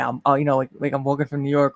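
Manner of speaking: ordinary talk, nothing marked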